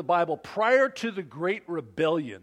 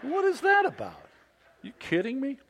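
mock indignation; 8 semitones higher than usual. Indignant about closed truck.

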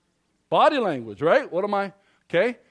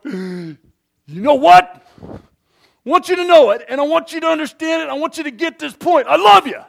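much higher and louder, yelling "I love you" like a threat; shouted love; 9 semitones higher, 11 dB louder than usual. Shown as a demonstration. Pastor showing the flip side to the congregation, furious yell. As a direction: bellow affection in a rage. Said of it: "You're confused".

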